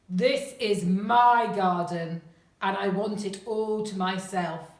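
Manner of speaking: loud, possessive giant's bellow; fierce giant. A narrator's reading. Selfish giant claims the garden from children, fierce bellow. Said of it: "his voice so very, very fierce".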